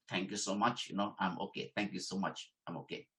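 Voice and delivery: soft, polite "thank you so much, I'm okay"; softly turning down tea from the interviewer; polite soft refusal. Shown as a demonstration. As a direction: decline gently and quietly. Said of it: "politely you say no".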